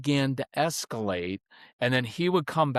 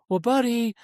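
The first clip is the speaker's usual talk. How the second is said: high, sing-song mommy voice; sing-songy mom tone; 5 semitones higher than usual. Acted. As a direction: coo it like an over-soothing mom. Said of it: "that sing-songy mommy voice".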